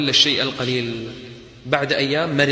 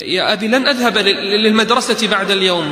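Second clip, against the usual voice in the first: higher, louder tearful plea; crying daughter; 6 semitones higher, 4 dB louder than usual. Retold or imitated. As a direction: cry it out to father. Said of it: "بكت". (البنت تبكي وتتوسل لأبيها)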